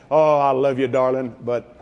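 low mock-tender sweet talk; hedging husband. Husband buttering up his wife, low hedging. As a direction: pitch it low, leading up to a catch.

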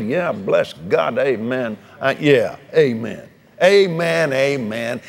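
slow, low, drawn-out amens; lightly voiced eager believer nodding along; mock fervent preacher. Preacher mimics a fervent man at the store, slow and low.

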